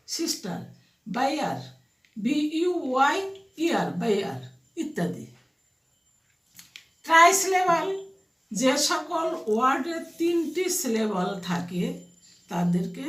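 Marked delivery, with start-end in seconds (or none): none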